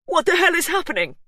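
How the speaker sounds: high, loud panic; husband crying out as wife collapses; panicked cry. A narrator's reading. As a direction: cry out in terror. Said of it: "Hugh cried".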